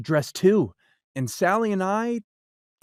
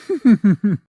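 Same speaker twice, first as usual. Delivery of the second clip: booming cat laugh; 8 dB louder than usual. Acted. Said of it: "laughed the cat".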